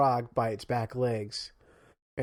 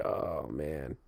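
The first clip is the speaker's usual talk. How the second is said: low, soft, drained groan of words; worn-out pickerel; 11 semitones lower, 8 dB quieter than usual. Acted. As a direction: sigh it out like an exhausted fish. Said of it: "just laying there, just like".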